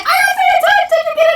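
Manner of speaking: high, loud shout of joy; excited shout. Retold or imitated. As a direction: yell it to your friend across the street. Friend voiced shouting excitedly.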